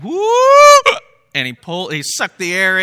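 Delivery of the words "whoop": very high, loud whistle imitation; shrill mock whistle blast; whistle whoop. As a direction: squeal it out like a slide whistle. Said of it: "and it went".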